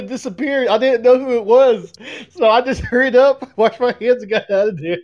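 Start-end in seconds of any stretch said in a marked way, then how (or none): none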